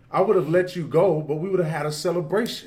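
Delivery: lightly voiced festive Laban; Laban on the party. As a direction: sell the celebration he missed. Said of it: "He's basically saying".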